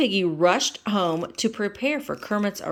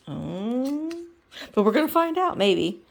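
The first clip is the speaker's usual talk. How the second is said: ordinary delivery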